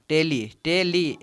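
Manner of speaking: "टेली" slowly, both vowels drawn out; teacher showing both syllables of "टेली"; syllable demonstration. Shown as a demonstration. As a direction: sound out "टेली" slowly, twice. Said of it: "ई का sound आ रहा है".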